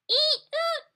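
high squeaky dolphin whistle, eek then oot; dolphin squeak. Shown as a demonstration. Reading to kids, imitating a dolphin's whistle, high squeak. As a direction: chirp it like a dolphin calling.

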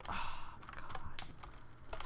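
breathless slow whisper; exasperated whisper. Real, not acted. Player whispering to himself after a fall, defeated. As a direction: barely breathe it out in disbelief.

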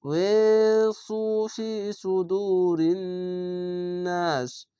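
slow chanted recitation, last word held long; teacher demonstrating tajweed; Quran recitation. Shown as a demonstration. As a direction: chant it and stretch the final নাস. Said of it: "সঙ্গে আমি পড়ছি".

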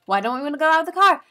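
high, fast, puzzled asking; baffled question. Retold or imitated. Survivor asking why they must stay in the car, high and fast. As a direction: rattle the question off in disbelief. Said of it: "I was like".

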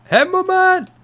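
high, loud excited yelling at the screen; hype shout. Real, not acted. Streamer yelling to his UFC fighter, loud excited shout. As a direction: cheer the fighter on at full volume.

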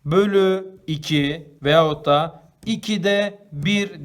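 each word of "bir bölü iki" drawn out slowly and loud; slow reading demo. Shown as a demonstration. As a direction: read "bir bölü iki veyahut da ikide bir" slowly and loudly for the kids. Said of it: "diye okuyoruz".

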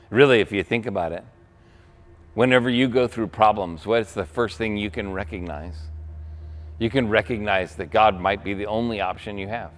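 usual speaking voice

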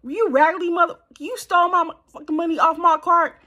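put-on high, loud furious yelling at the boyfriend; going off on him. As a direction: tear into him like he robbed you blind. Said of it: "man, I started going off on him".

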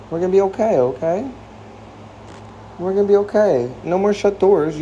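high, gentle reassuring coo; soothing pet talk. Real, not acted. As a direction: owner calming her scared cat in a high, tender coo. Comforting a scared cat.

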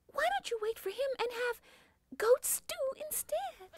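very high, squeaky tiny goat voice begging; a frightened little kid talking his way out; pleading baby goat. Acted. Baby Gruff begging the troll to wait for his brother, very high and pleading.

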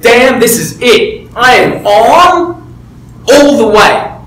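excited. Retold or imitated. Retold moment of breakthrough.

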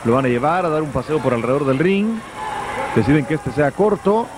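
ordinary talk

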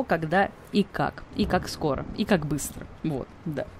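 normal talking voice

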